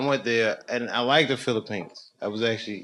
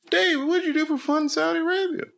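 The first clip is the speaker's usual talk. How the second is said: mock questioner. Imagined question from a fan.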